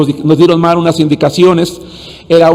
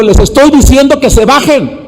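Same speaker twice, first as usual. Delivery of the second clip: raised, higher-pitched exasperated order; retold shout; 6 semitones higher than usual. Pastor turning to yell at brothers in the sliding truck, retold shout. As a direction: shout it back at them, reenacting the moment. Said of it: "Volteo y les grito".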